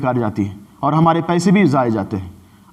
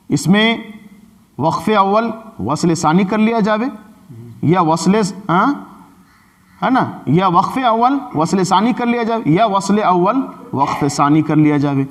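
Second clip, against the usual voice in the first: ordinary talk, nothing marked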